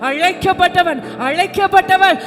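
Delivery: loud, high, rousing pulpit cry; impassioned, shouted exhortation; full-force preaching. Real, not acted. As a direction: proclaim it to the congregation at full force.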